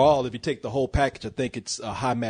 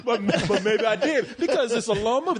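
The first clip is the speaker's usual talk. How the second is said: laughing; 8 semitones higher than usual. Real, not acted. Laughing while backtracking.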